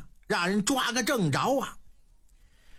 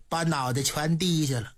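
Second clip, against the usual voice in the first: plain reading voice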